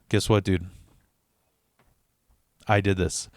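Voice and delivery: low, soft reveal; quiet confession. Retold or imitated. Teller admitting to his friend he staged it, softly and low. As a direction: drop it down, deadpan and hushed.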